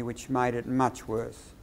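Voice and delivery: "much worse" dropping low and soft to a full stop; downward inflection. Shown as a demonstration. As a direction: let "much worse" fall away slowly and stop. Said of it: "you stop with a downward inflection".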